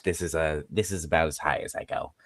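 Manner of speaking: softly showing the top of the comfortable range; modest, soft high-pitch demonstration; high pitch demo. Shown as a demonstration. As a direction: show how high you go, quietly. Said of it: "as for high pitch".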